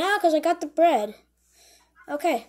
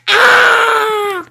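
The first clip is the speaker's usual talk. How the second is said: long, high, loud wail; trap scream; 7 semitones higher, 12 dB louder than usual. Acted. Mouse caught by the trap, screaming loudly. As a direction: scream as if suddenly snapped in a trap.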